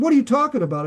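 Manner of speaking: shocked question. Retold or imitated. Church member reacting to the pastor's Bible quote, shocked. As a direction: act out the outraged listener.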